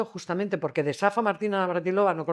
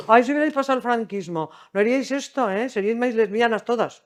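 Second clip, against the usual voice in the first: higher, louder mock-complaining older generation; mock whining elder; 6 semitones higher, 6 dB louder than usual. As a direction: whine like an elder lecturing the young. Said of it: "nos ponemos ahí a lloriquear".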